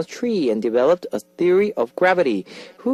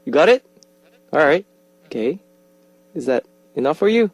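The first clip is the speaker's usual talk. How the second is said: ordinary talk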